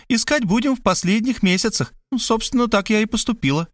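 high-pitched, businesslike woman's voice; eager woman taking charge; busy, deducing woman. Acted. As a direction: lay out your deductions in a lifted voice. Voices Мархалёва laying out findings.

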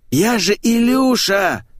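high, drawn-out offended protest; hurt drawl. A narrator's reading. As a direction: stretch it out, wounded and high. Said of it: "обиженно протянул попугай".

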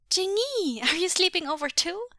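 put-on very high, loud little-kid question; excited child. A narrator's reading. Boy greets cousin at sleepover, excited. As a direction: squeal the question to a playmate.

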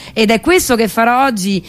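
ordinary delivery

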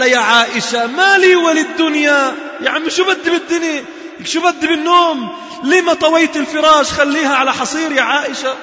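high, loud cry ending in a shout; impassioned cry. Acted. Umar crying out to his daughters to let him rise, loud. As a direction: plead with them, rising to a shout.

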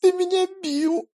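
very high, hurt tearful words; tearful reproach. Acted. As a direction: accuse him through tears. Pyotr accuses while crying.

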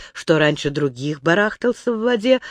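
plain reading voice

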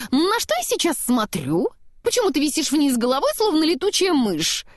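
high, wondering snowman; puzzled surprise. A narrator's reading. Upside-down snowman questioning what he sees, puzzled. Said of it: "удивлённо глядя вокруг на перевёрнутый мир".

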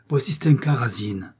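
usual speaking voice

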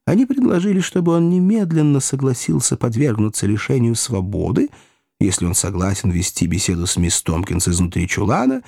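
usual speaking voice

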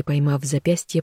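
ordinary delivery, nothing marked

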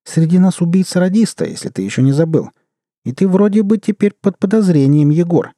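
high, pointed woman's voice; accusing woman character. Acted. Sveta telling Egor he is under suspicion, accusing.

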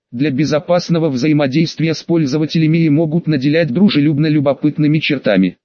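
plain reading voice

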